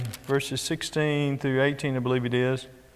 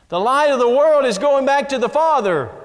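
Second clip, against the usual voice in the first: louder and higher, preaching it out; emphatic proclamation; 7 semitones higher, 5 dB louder than usual. Real, not acted. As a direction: proclaim it to the pews.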